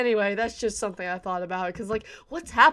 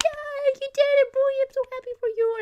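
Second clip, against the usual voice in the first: much higher, gushing proud voice; 3 dB louder than usual. Retold or imitated. Fan congratulating Eugeo on his win, in a high gush.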